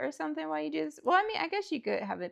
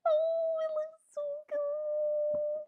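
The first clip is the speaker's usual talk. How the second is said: delighted gush; slower than usual. Real, not acted. Admiring her build.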